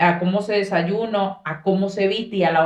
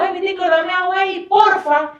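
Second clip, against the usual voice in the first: pedido brusco imitado; 10 semitones higher than usual. Retold or imitated. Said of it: "yo le decía a un primo".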